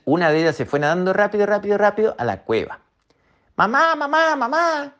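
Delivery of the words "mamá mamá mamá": put-on high child calling out, much higher; a little otter running in for help; calling mom. Otter baby calling her mother urgently, high.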